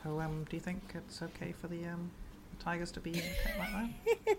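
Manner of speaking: very soft, tentative interviewer; hushed documentary questioning, gently probing; Louis Theroux. Acted. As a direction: ask it very quietly and hesitantly. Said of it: "that's basically Louis Theroux through and through".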